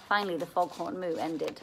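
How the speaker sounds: ordinary delivery, nothing marked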